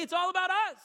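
high, loud, mocking take on a self-centred church; mock selfish church. Preacher mocking the church's self-focus, high and loud. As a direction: sneer it out as the church's smug motto. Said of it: "We go to verse five and say".

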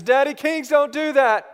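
put-on high, loud child scolding; little daughter. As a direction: imitate a small girl correcting her dad. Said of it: "Maggie says".

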